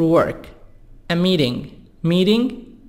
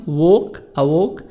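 ووك with rounded British 'aw' vowel; British-accented 'walk, a walk'; British pronunciation; 2 semitones lower, much faster than usual. Shown as a demonstration. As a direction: say أووك as British 'a wawk'. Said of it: "نطق البريطاني".

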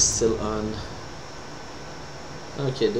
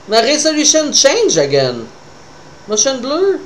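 excited; 11 semitones higher, 4 dB louder than usual. Real, not acted. Reacting to resolution glitch.

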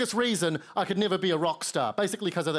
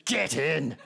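a hint of menace, low and clipped; menacing growl; 5 semitones lower than usual. British man celebrating with a shaking fist, menacing. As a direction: spit it with venom. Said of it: "said with such malice and aggression".

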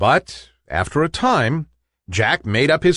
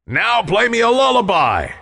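higher, booming command to the harp; roaring demand; 8 semitones higher than usual. A narrator's reading. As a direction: bellow it like a giant giving orders. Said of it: "roared the giant".

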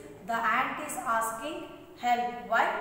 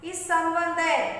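higher, louder calling out; acted call for help; 4 semitones higher, 7 dB louder than usual. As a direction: call into the empty woods for help. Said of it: "Then this ant shout".

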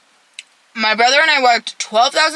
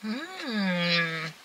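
chewing while humming; eating salad; mouth full; 3 semitones lower, 13 dB quieter than usual. Real, not acted. Seen 3: Cheeks full, mouth closed chewing salad, fork raised throughout.

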